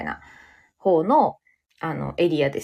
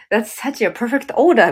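louder, slow, pleased praise; delighted praise; 5 semitones higher, 6 dB louder, slower than usual. Retold or imitated. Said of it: "みたいなね". (客を褒めて嬉しそうに大きめの声で)

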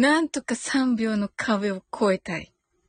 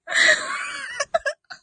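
high shrieking laugh burst. Real, not acted. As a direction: squeal with laughter. Explosive laugh.

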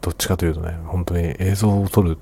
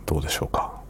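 whispering; 4 dB quieter, faster than usual. Real, not acted. Late-night quiet talk.

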